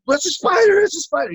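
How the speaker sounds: high mock alarmed voice; shrill mock fright at a bug; boy learns fear of spiders, high mock alarm; startled spider scare.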